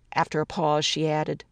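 ordinary delivery, nothing marked

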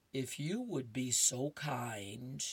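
lightly voiced cat, slow and lofty; slow polite Garfield. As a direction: drawl it like a smug cat. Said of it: "Garfield says".

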